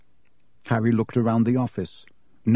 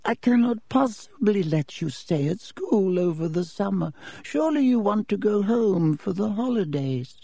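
put-on old headmaster voice; 9 semitones higher than usual. A narrator's reading. Headmaster Dippet speaks.

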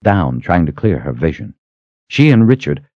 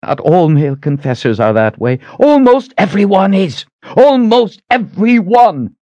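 raised voice climbing to a scream; hammering, screamed insistence; 13 semitones higher, 4 dB louder than usual. A narrator's reading. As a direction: build to a high, furious cry. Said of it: "Shota was screaming at her".

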